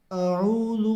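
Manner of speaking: اعوذ with hamza first, then a clear throaty ain; clear ain sound. Shown as a demonstration. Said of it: "عین کی آواز نکالنی ہے".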